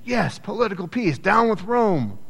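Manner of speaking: high-pitched mock crowd cheer; rallying cheer. The crowd hailing a political liberator, rallying cheer. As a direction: chant it high like a fired-up mob.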